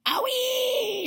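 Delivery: very high, loud squeal; scream. A narrator's reading. Grandma jolted by a second dose, scream. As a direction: let out a long high scream. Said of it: "she screamed".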